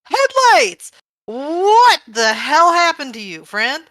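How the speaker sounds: high, loud, astonished cry; shocked exclamation. Acted. Friend discovers the changed Headlights, loud and shocked. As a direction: exclaim it high, then slow down in disbelief.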